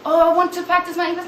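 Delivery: high, loud, rushed eager student. Retold or imitated. Students begging to practice English with their teacher, eager. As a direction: blurt it out fast and keen.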